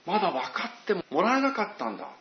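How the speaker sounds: sighing disappointment. Retold or imitated. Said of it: "罵っているわけじゃないんです".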